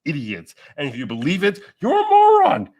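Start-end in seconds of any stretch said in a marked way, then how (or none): none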